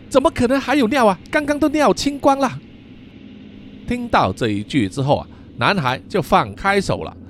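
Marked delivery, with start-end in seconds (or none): 0.1-2.6 fast and much higher, exasperated; struggling protest. Acted. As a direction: squirm free and blurt it out quickly. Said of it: "一面挣扎一面摇头说". (一边挣扎一边急快高声地说)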